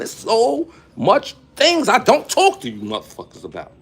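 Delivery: usual speaking voice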